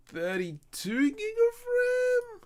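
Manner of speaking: very high, slow squeak; silly squeaky voice. Acted. Answering chat's hardware question in a very high, slow squeak. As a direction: squeak the numbers out like a cartoon.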